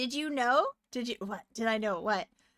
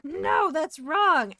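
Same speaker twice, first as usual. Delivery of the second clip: mock correction; 6 semitones higher, 8 dB louder, much slower than usual. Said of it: "who's like".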